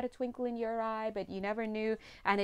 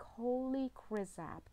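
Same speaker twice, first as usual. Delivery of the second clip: mock amazement; 7 dB quieter, slower than usual. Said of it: "then you're like".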